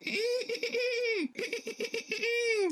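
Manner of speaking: soft, high bleating hum; sheep bleat imitation. Boy showing dad how sheep really sound, soft bleat. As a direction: bleat quietly like a real sheep. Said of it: "They, they go".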